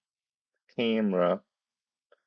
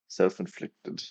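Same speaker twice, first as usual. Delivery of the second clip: speaking around a swollen tongue; stumbling over words with a bitten tongue; 5 dB quieter than usual. Real, not acted. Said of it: "I can't speak".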